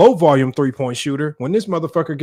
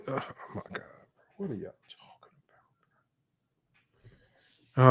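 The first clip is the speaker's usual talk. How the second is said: breathy disbelieving whisper under the breath; a real stunned whisper; exasperated whisper; 14 dB quieter, faster than usual. Real, not acted. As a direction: whisper it like you can't believe them. Disbelief at term foul baiting.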